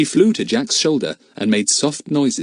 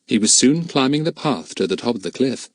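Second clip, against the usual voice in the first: plain reading voice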